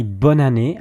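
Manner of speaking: bonne année run together as bo-na-né; as one word. Shown as a demonstration. Teacher showing learners how to connect sounds, as one word. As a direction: let bonne année flow with no gap. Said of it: "if it was just one word".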